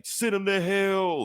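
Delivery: high, slow, booming god-king decree; mock Odin proclaiming doom; Odin's voice. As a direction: thunder it down like an all-father. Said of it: "That's what Odin's like".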